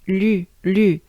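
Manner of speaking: "lu" with the French U vowel. Shown as a demonstration. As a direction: demonstrate "lu": L gliding into French U. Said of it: "this sound U goes into lu".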